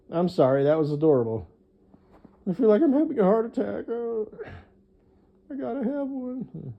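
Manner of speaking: put-on high, fast gushing over a baby; imitating her melting over a baby, high and breathless; mock gushing. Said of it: "She was like".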